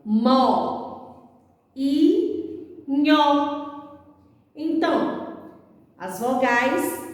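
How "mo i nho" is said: mo, i, nho as separate beats with pauses; syllable by syllable. Shown as a demonstration. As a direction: break it into mo... i... nho. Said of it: "Vamos separar? Fica assim".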